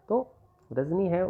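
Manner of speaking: ordinary delivery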